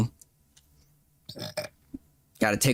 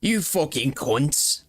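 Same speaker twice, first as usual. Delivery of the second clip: mock British accent; much slower than usual. Mocking British old man voice.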